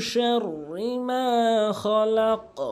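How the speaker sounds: slow, high drawn-out chant; Quran recitation. Shown as a demonstration. Teacher recites the verse part as the model. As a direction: recite শাররি মা খলাক melodically, slowly. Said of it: "এভাবে উচ্চারণ করবেন".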